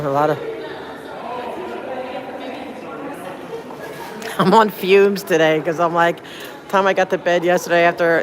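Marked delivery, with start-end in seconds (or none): 0.4-4.3 a long, airy laugh, high and soft. Real, not acted. Laughing to her stream viewers about running on fumes. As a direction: laugh it off, drained and giddy.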